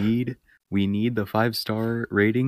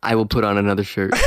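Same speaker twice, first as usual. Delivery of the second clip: ordinary talk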